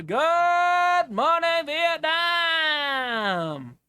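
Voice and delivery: very drawn-out, high, loud radio-style holler; booming catchphrase shout. Acted. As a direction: belt it out, stretching every word. Shouted movie catchphrase impression.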